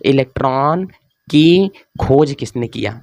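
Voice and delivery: drawn-out words, pausing midway; slow dictation. Real, not acted. Teacher writes up the question for students, slow dictation. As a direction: stretch each word as if writing it.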